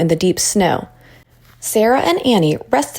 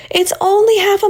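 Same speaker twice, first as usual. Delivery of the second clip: high-pitched teacher's call, pushed out; yelling over the wind; 13 semitones higher than usual. A narrator's reading. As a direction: call out to the children above the storm. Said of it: "Miss Freeman was yelling".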